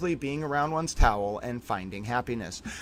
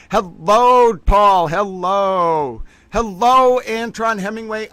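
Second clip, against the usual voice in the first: loud greeting; 7 semitones higher, 9 dB louder, slower than usual. Real, not acted. Shouted hellos to chat.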